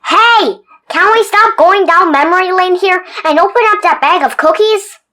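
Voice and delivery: impatient tooth; pushy tooth. Acted. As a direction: demand the cookies. Tooth demanding cookies.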